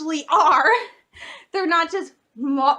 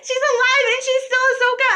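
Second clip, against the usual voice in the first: excited; 7 semitones higher than usual. Retold or imitated. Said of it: "So I was like".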